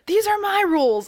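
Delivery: high-pitched, insistent retort; mock yelling. Imitating her answer to his rules, in a high-pitched mock yell. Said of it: "after she's yelling".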